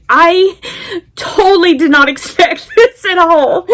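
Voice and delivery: overwhelmed, half-sobbing, half-laughing; laughing through tears. Real, not acted. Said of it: "this crazy cat lady crying".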